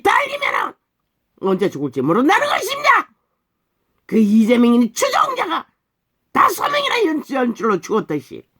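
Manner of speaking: high, shouted pulpit warning; full-force preaching. Real, not acted. Preacher warning that those chasing evil may die, full-force shouted preaching. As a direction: build each phrase to a high shout.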